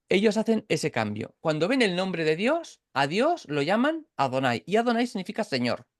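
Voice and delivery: usual speaking voice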